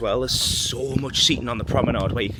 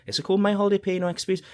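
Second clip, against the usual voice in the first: quick, higher, doubtful question; unsure question; 7 semitones higher, much faster than usual. Real, not acted. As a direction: ask it quickly, doubting yourself. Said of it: "I don't think it's called".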